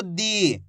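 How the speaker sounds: "दी" with a long, held ee; drawn-out vowel. Shown as a demonstration. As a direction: stretch out the vowel of "दी". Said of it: "खींच खींच के पढ़ेंगे".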